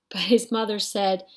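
plain reading voice

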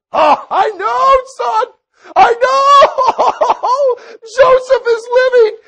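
high joyful shout, laughing through tears; overjoyed father. Acted. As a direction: cry out the good news, laughing and weeping. Overjoyed father hears Joseph lives.

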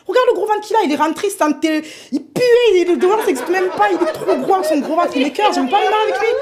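plus aigu, moqueuse et écœurée, entre rires; dégoût moqueur. Acted. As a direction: se moque du gros ventre du prétendant. Fille dégoûtée décrit le prétendant. (fille écœurée qui se moque de Hassani en riant)

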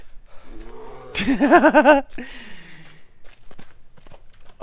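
loud high cackle after dying; laughing. Real, not acted. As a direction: burst out laughing in disbelief.

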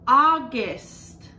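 August called out loud for repeating; loud model word. Shown as a demonstration. Teacher models August for kids to repeat, loud. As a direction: belt out August for the class. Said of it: "Can you say August?".

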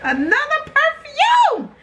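high, drawn-out shriek of delight; ecstatic shout. Real, not acted. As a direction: shout it slowly, high and thrilled. Thrilled on finding another perfume.

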